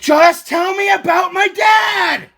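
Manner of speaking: slow, high, shouted demand; drawn-out furious shout; yelling. Real, not acted. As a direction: yell it out word by word, desperate for an answer. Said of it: "Stop yelling at me".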